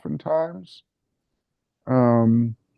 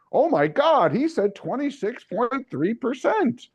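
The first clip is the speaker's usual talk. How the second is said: raised, breathless mock awe; mock awed liberal; 8 semitones higher than usual. Dumb liberal gushing over a statistic, with a lightly raised mock awe. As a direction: gush like a gullible liberal.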